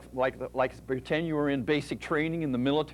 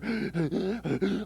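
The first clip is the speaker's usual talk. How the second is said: breathless gasp for air; 5 semitones higher than usual. Shown as a demonstration. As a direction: gasp like you can't breathe. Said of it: "you can hardly breathe".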